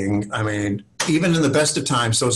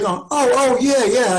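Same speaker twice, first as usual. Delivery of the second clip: much higher, hasty fake recall; sudden recognition; 12 semitones higher, 3 dB louder, slower than usual. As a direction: pretend you remember him. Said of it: "I'm like".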